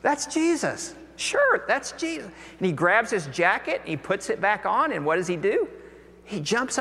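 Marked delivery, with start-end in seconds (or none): 0.0-2.3 very high "That's Jesus. Sure, that's Jesus", "Sure" pushed up; excited echo. Retold or imitated. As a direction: blurt it back, thrilled and half in disbelief. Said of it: "Peter goes".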